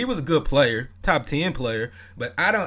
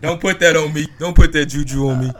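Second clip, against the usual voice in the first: loud opinionated ribbing; 3 semitones higher, 9 dB louder than usual. Real, not acted. Laughing protest at friend.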